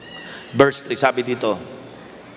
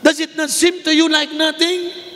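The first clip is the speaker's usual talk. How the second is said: high rebuke; 14 semitones higher than usual. Acted. Second, heightened delivery of quote.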